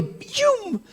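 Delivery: high, drawn-out "pium" sound effect; imitated whoosh. Telling how Sam ran into the pool, playful imitated whoosh. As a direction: mimic someone shooting off into water.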